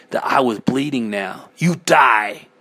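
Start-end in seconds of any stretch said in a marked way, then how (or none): 1.6-2.5 raised voice, vengeful cry; an understated scream of attack; mild scream. A narrator's reading. Narrator cutting at the eye on their arm, mildly screaming. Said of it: "I screamed at it".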